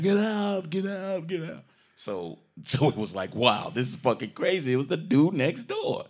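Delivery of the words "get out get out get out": high fake French, fading off; mock French get out. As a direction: repeat it in a put-on French voice, trailing away. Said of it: "So she was like".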